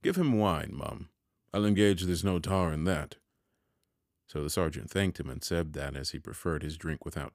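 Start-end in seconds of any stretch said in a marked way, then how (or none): 0.0-3.2 sharp. A narrator's reading. Pumblechook correcting the sister about the sergeant's drink, sharply. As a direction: snap the order out over the sister.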